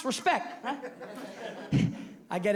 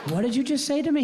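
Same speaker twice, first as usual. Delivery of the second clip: shocked outrage; 5 semitones higher, 3 dB louder than usual. Retold or imitated. Stunned dad confronting son.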